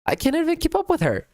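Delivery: high, fast squeaky complaint; high-pitched panicked whine; exasperated squeal. Real, not acted. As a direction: squeal it out, overwhelmed. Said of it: "Oh my God, she's going so fast".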